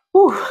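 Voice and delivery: a high strained whew of exhaustion; worn-out gasp; winded whew. Real, not acted. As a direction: blow out hard after the effort. Exhausted exhale after set.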